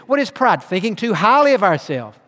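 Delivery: normal talking voice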